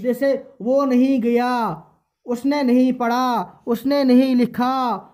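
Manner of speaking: slow examples with نہیں leaned on; teacher dictating, stressing نہیں; stressing nahin. Shown as a demonstration. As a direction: press on نہیں in each example. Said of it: "اب یہ نہ کو بتا رہا ہے نا".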